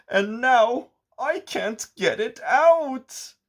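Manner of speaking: rising, pleading sad voice; a big friend near tears; sad sea giant. A narrator's reading. Sea giant telling the friends he lost the pearl, sadly. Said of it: "he looked sad".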